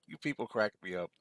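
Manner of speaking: ordinary talk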